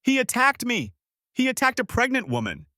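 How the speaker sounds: very high, hysterical accusation; shrieking. A narrator's reading. Sister dragged off, accusing him before the mourners, shrieking. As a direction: shriek it for everyone to hear.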